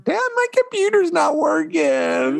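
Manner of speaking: put-on high, slow whiny kid voice; mock the daughter pleading with Dad; imitating daughter. Father imitates daughter complaining about broken computer, high and whiny.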